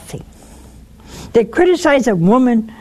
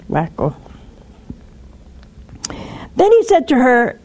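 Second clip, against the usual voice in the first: ordinary delivery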